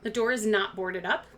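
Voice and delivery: usual speaking voice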